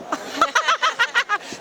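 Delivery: high burst of laughter; amused laugh. Real, not acted. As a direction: laugh out at the memory. Laughs at Enda's mojo.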